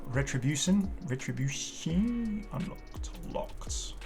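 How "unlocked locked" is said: normal talking voice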